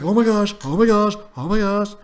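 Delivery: mock excited gamer. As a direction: overdo his excited exclaiming. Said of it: "he's like".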